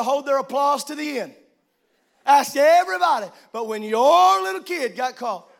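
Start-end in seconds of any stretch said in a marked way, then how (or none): none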